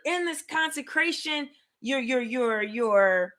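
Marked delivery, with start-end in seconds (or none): none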